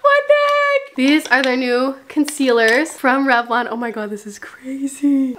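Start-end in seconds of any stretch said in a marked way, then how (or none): 0.0-1.0 high, drawn-out astonished gasp; gasp of surprise. Real, not acted. Gasping at new concealers. 3.7-5.4 whispered amazement; awed whisper. Real, not acted. Awed at unboxing.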